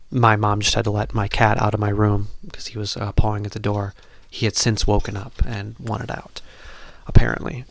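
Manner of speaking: loud, carrying voice that she apologises for. Real, not acted. Speaking loudly to viewers while the cat scratched at the door. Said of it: "like, way louder for some reason".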